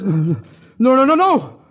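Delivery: high, loud frantic nos; desperate escape, voice way up; panicked refusal. Acted. Hero panics, flees through window.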